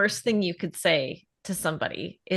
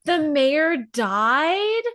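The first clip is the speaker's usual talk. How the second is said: higher, very drawn-out shocked question; mock horrified surprise; 4 semitones higher, much slower than usual. Americans hearing he's passed away, slow mock-horrified question. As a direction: imitate a stunned listener, stretching the words slowly. Retold Americans gasp at news.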